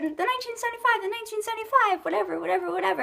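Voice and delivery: high, rapid mock chatter; dismissive parroting. Vlogger mimicking talk of a band she kept hearing about, dismissive parroting. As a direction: rattle it off high, as overheard hype.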